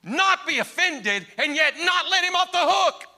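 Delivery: rising to a loud, high preacher's cry; preaching at full force. Real, not acted. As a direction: build it up, pleading with the congregation.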